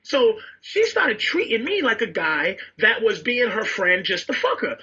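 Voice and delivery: ordinary delivery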